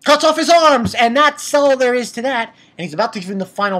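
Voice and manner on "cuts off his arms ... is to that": high, loud, punchy recap; loud, high-pitched dramatic announcement; excited climax. Real, not acted. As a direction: deliver the finishing move with raised voice. Climax of the retold fight.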